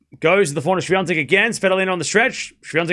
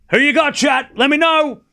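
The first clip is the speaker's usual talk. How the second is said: excited call-out; 10 semitones higher, 4 dB louder than usual. Real, not acted. Calling out to chat.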